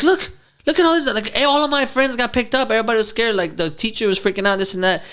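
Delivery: high, insistent retold plea; worked-up kid, pitched high; urgent child pleading. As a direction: plead with parents to take it seriously. Child pleading with unconcerned parents.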